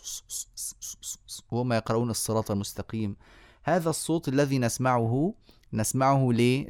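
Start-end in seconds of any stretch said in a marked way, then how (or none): 0.0-3.2 whispered س- hisses like worshippers reciting; imitated hissing. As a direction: breathe out a string of soft س- hisses. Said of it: "ما نسمع منهم إلا س".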